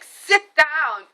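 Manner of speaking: high, loud bossy order; imitating a bossy command; mock bossy snap; bossing around. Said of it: "bossing me around".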